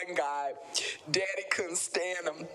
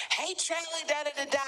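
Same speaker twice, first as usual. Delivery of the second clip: high-pitched imitation of a caller; mock greeting pitched way up; breezy greeting; 12 semitones higher, faster than usual. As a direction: toss out a high, singsong hello, mimicking a guest. Voicing guest's breezy greeting.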